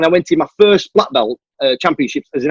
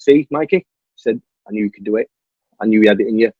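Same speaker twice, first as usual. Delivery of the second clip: gentle proud coach; 8 semitones lower than usual. Retold or imitated. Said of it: "He went".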